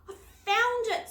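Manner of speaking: high, loud triumphant shout; excited cry. A narrator's reading. Girl detective cries out as the wall opens. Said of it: "Susie cried".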